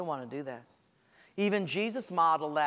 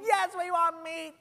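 insistent whine; 10 semitones higher than usual. Retold or imitated. Israelites insisting.

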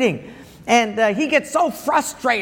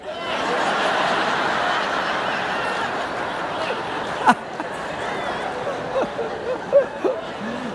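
long high breathy laugh; 13 semitones higher, 4 dB quieter than usual. Real, not acted. Preacher laughing at his own punchline, long and high. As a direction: laugh at your own joke.

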